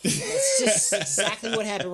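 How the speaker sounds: slow, through held-in laughter; words squeezed out past a laugh; cracking up mid-sentence. Real, not acted. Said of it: "so funny you can't laugh".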